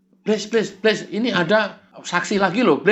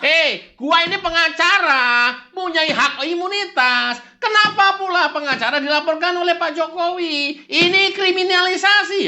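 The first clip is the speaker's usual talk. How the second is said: indignant high lawyer; 9 semitones higher than usual. Acted. Kurnia protests being reported, indignant and high. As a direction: play up the injured lawyer.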